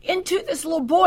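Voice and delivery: re-enacted urgent prayer aloud; crying out in prayer. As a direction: cry out to God, pitch and volume up. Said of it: "He cried out to God".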